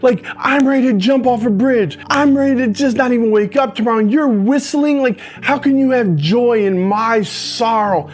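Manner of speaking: furious inner outburst, high and loud; outraged rant. Retold or imitated. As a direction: rant at him like he betrayed you. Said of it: "I'm like".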